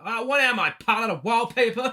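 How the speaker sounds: hammed-up dad snapping at his daughter; mock dad. Dad voiced in retold scene.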